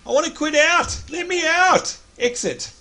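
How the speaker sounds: very high-pitched comic pleading; mock plea. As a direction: beg to escape in a high, put-on voice. Mock plea to quit the game.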